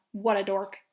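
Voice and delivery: usual speaking voice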